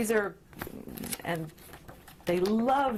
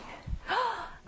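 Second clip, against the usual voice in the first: put-on high, soft surprised gasp for a toddler; mock gasp; 12 semitones higher, 5 dB quieter than usual. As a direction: gasp in pretend wonder. Toddler shows a sheep.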